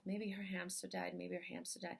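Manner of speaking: soft, private mantra under the voice; saying to oneself. Retold or imitated. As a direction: repeat it quietly to yourself. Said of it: "I just kept saying to myself".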